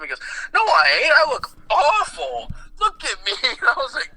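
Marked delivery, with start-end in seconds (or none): 0.5-3.3 imitated wailing grandpa. Grandpa refusing her comfort about his looks, imitated wailing. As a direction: cry out in hammed-up high-pitched anguish.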